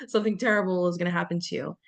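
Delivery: normal talking voice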